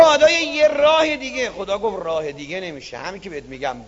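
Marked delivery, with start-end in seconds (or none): 0.0-1.5 loud plea. Acted. Climber pleads loudly. 2.0-3.8 quiet firmness. Acted. God firm and quiet.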